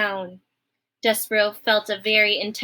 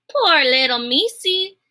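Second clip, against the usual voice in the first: higher, louder, slow coo; pitying Midge; 5 semitones higher, 5 dB louder than usual. Acted. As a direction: croon over the little mouse.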